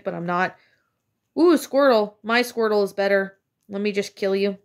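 ordinary delivery

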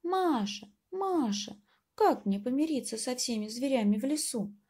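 high, pleading little mouse. Acted. Mouse pleading to Masha for help, high and small. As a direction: call out to Masha as a tiny mouse. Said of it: "прибежал мышонок к Маше и говорит".

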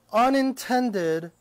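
unintended, each syllable slow and weighted; stress on unintended. Shown as a demonstration. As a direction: lean hard on unintended, slowly. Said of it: "underline that word".

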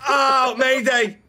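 a slow, loud mayday cracking into laughter; laughing mock mayday. Officer calling mayday during a scuffle, laughing and slow. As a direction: cry for help while cracking up. Mock distress call while wrestling suspect.

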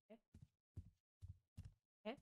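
ordinary talk

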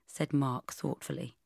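plain reading voice

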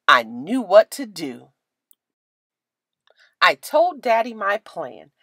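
ordinary delivery, nothing marked

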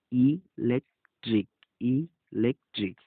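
ইলেকট্রিক slowly, with ই on its own before the rest; separated syllables. Shown as a demonstration. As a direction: break ইলেকট্রিক into syllables with gaps. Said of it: "ই বর্ণ কিন্তু পৃথকভাবে উচ্চারিত হয়েছে". (ইলেকট্রিক ধীরে, ই আলাদা করে)

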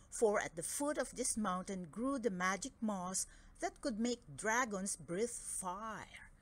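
plain reading voice

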